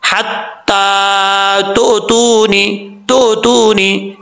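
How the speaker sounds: हत्ता तु, तूनी drawn out, each syllable separate; तु, तूनी recited at half speed; slow recitation demo. Shown as a demonstration. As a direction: chant तूनी slowly with a glottal catch. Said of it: "वो कैसे पढ़ा जाता है".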